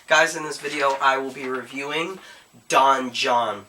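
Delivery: ordinary talk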